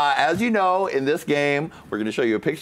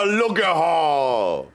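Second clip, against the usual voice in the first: long drawn-out showy call; hammy, held-out announcer call; game-show catchphrase; 2 semitones lower, much slower than usual. Pretend. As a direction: stretch every word like a game-show host. Host calls the catchphrase.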